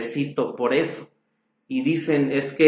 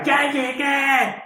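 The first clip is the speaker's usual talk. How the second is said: mock shout; 11 semitones higher, much slower than usual. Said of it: "tenía una voz que llegaba a los eventos y gritaba".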